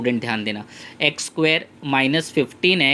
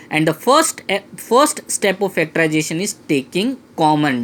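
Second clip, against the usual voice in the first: slow emphatic rule; much slower than usual. Shown as a demonstration. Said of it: "always remember this final statement".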